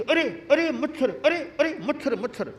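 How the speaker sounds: high, startled cries of annoyance; startled yelps. Acted. Guru bitten by a mosquito, high startled cries. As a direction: jump at the bite, flustered.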